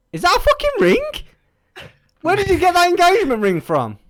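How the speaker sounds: very high and loud fake shock; mock astonished shriek. Streamer hams up surprise at the ring, high and loud. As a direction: gasp it out like a hammed-up fangirl.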